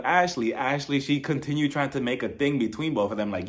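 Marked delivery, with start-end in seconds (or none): none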